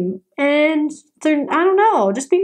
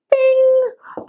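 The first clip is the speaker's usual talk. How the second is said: high sung bing of the train chime; 11 semitones higher than usual. Retold or imitated. Imitating the train chime for viewers.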